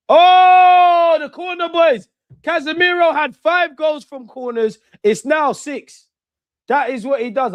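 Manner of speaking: high, loud joyful yell; goal celebration shout. Real, not acted. Fan watching his team score from a corner, roaring with joy. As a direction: roar it out as the ball goes in.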